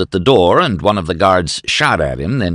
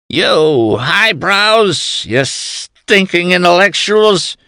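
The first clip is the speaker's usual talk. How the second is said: put-on high, slow drunken shriek; 12 semitones higher, 3 dB louder, slower than usual. A narrator's reading. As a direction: screech the insult at them. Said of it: "McGoblin shrieked".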